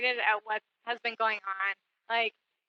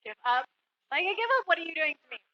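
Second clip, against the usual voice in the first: mock giving up; 5 semitones higher, faster than usual. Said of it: "I was like".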